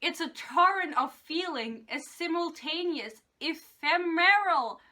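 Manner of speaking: hammed-up romance-novel recital; dramatic reading. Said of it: "I'm gonna read it out".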